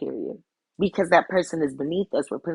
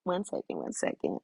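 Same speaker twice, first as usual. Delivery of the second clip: very soft, fading to voiceless; murmured aside; 10 dB quieter than usual. Real, not acted. Reader asking listeners to hold on, murmured aside. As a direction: murmur it to yourself, barely audible.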